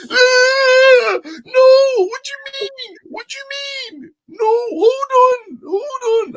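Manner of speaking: very high, wailing mock protest; hammed-up high-pitched whiny yelling; Russ. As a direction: imitate someone crying out in drawn-out, squealed protest. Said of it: "I hear Russ do that all the time".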